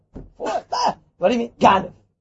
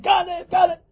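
high shouted cry of thief; mock scream; 8 semitones higher than usual. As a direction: yell it down the street. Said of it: "start screaming in the middle".

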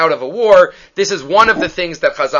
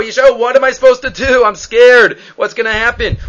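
frightened plea; 5 semitones higher, 4 dB louder than usual. Retold or imitated. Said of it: "he says to Yeshayahu".

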